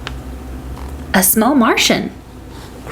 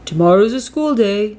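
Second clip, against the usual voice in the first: dad voice. A narrator's reading. Dad at bedtime.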